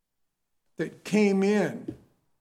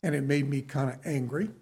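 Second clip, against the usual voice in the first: normal talking voice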